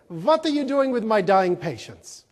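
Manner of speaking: put-on strong, indignant Swiss accent; passionate Swiss accent. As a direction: confront them hotly in a strong Swiss accent. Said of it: "passionate Swiss accent".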